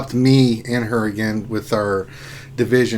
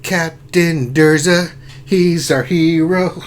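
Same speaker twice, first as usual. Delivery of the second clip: loud, slowing heroic chant; mock superhero theme; 5 semitones higher, 6 dB louder than usual. Host joking about Urs and Durgan combining powers, heroic. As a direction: proclaim it like a cartoon hero intro. Parodies cartoon hero theme.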